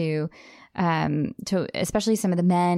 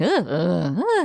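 cracking ugh grunts; voice breaking grunts; much slower than usual. Shown as a demonstration. Showing the actor's pitch breaks, cracking grunts. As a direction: let the voice break on each ugh. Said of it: "voice breaks".

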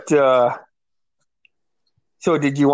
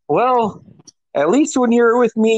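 higher, playful ribbing; teasing friend; 8 semitones higher than usual. Retold or imitated. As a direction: laugh at his hangover.

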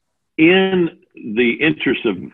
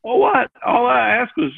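high, wide-eyed feigned innocence; mock innocent surprise; 13 semitones higher than usual. Husband pretending he meant nothing by it, mock surprised. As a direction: play dumb, all innocent.

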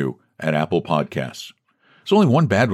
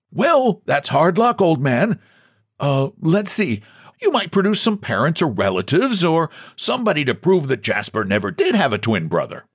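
put-on higher, mildly soothing old man; comforting; 9 semitones higher than usual. A narrator's reading. President comforting John, mild sympathy. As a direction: comfort him gently. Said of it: "he comforted".

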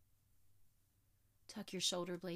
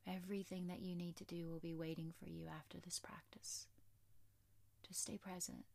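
soft spa whisper; 6 dB quieter than usual. Acted. Yoga teacher whispers reassurance.

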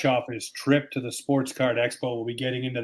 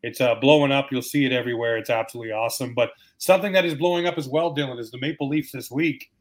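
usual speaking voice

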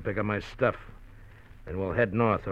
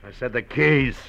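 louder, hard, threatening order; menacing demand; 3 semitones higher, 8 dB louder than usual. Acted. Thug demands the car keys.

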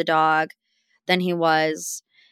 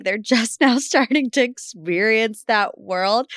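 ordinary talk

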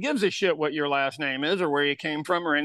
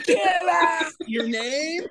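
high incredulous shout; amused disbelief; 9 semitones higher, much slower than usual. Real, not acted. Host hearing co-host misspelt own name, incredulous shout. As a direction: burst out in disbelief.

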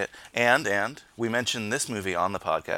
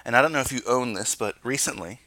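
normal talking voice

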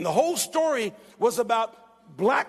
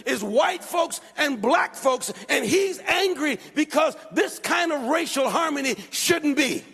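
raised, high-pitched sermon delivery; fervent preaching; 5 semitones higher than usual. Real, not acted. Preacher laments an angry young man to congregation, fervent preaching. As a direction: preach it with urgent, driving force.

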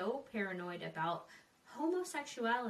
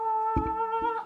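frustrated yell; 13 semitones higher, 12 dB louder than usual. Real, not acted. Frustrated outburst.

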